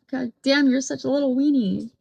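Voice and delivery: ordinary talk, nothing marked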